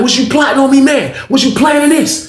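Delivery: high, loud accusing question; high confrontation. Retold or imitated. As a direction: call him out, pitched high. Accusing Booker.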